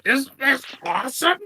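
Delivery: high goblin boasting; proud goblin. Acted. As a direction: goblin Splug shows off new body. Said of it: "I'm turning around".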